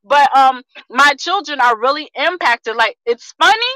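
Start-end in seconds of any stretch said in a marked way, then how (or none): none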